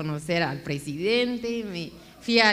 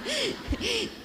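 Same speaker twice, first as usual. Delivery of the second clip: high laugh; 13 semitones higher, 4 dB quieter than usual. Real, not acted. Laughing at her own mistake.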